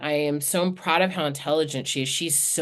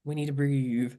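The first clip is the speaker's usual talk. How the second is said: calm daughter; 4 semitones lower, 4 dB quieter than usual. Retold or imitated. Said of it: "She's like".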